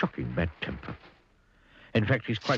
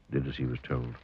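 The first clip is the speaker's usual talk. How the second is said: plain reading voice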